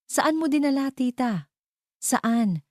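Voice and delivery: high, rapid-fire bitter demands; bitter, pained interrogation; pressing angry questions. Acted. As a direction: keep hammering the question. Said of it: "sunod-sunod na tanong".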